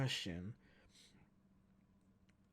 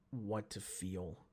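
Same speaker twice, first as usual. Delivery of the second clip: ordinary delivery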